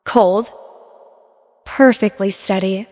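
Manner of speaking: ordinary delivery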